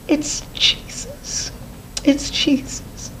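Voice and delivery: slow, high breathless whisper; awed high whisper. Retold or imitated. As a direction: whisper it in wonder, slowly. Recognizing Jesus, awed whisper.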